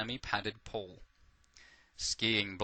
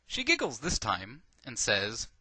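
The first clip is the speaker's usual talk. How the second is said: plain reading voice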